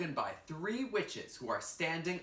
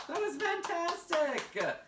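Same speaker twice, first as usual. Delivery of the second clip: excited; 12 semitones higher than usual. Real, not acted. Teacher praising after the play.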